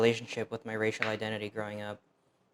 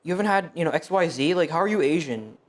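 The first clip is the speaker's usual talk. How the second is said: higher, louder mock-incredulous friend; mocking foodie friends; 5 semitones higher, 5 dB louder than usual. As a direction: needle her like a know-it-all foodie. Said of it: "they're like".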